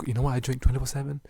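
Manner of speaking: loud, brisk hype question. Pretend. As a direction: throw the question out loud and quick. Repeats question, dropping into a whisper.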